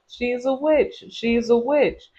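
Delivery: high-pitched mock accusation; put-on high voice of gossiping townsfolk; accusing townspeople. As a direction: imitate villagers crying witch, pitched well up. Townspeople accusing a woman of witchcraft.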